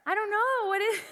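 high-pitched sheepish denial; feigned innocence. Retold or imitated. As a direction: play dumb in a high voice. Keeps feigning ignorance to boyfriend.

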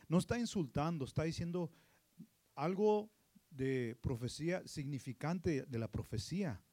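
normal talking voice

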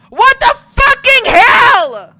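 screaming high and very loud, slow and drawn out; full-out scream. Real, not acted. Warning the listeners, then screaming at the wrestling. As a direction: let loose a long, shrieking scream. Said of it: "I'm going to scream very loud".

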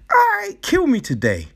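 high, fast mock-breezy consent; mock cheerful resignation. Mocking the idea he chose to die, high and breezy. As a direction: toss it off like it's nothing, mocking. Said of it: "he wasn't like".

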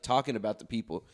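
normal talking voice

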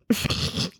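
short, soft little snicker. Acted. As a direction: snicker quietly at a sibling.

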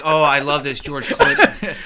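slow, laughing fake gush; mock gushing. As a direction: gush over it, half-laughing. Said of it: "I'm not like".

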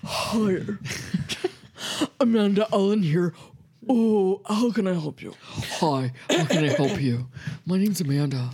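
low, put-on professional phone voice; deep phone voice. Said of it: "this deep voice".

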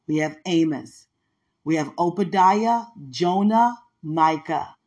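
loud, deliberate recital of the prophets; proud Bible-class recital; recitation. Acted. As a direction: call each book out clearly, like a drill.

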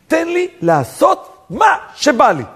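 high, slow, hammered-out cry; defiant demand. Retold or imitated. Egyptians insisting on doing as they please, defiant drawn-out cry.

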